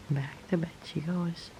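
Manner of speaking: hushed whisper. Real, not acted. Owner quietly sending kitten back to bed, whisper. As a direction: keep it down for the sleepy kitten.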